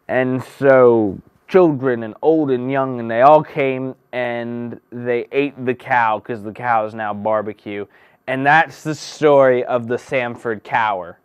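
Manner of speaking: loud, slow, hammed-up once-upon-a-time telling; booming mock fairy-tale narrator; storybook narration. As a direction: tell it like a bedtime tale to a big room.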